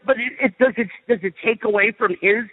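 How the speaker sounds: ordinary delivery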